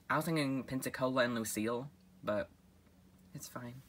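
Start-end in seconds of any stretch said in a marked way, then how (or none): 3.3-3.8 softly whispered sulk. Acted. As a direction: mutter it under breath, hurt.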